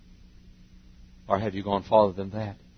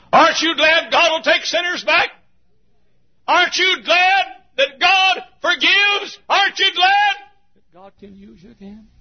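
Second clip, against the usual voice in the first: full-force preaching; 13 dB louder than usual. Acted. Preacher rousing the congregation, full force. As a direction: thunder it to the congregation.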